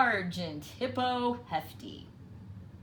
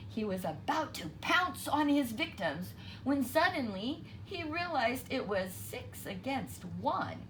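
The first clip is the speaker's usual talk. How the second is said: usual speaking voice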